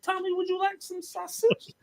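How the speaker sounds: high mocking singsong offer; teasing taunt. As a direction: taunt him with a sweet, high voice. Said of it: "I said".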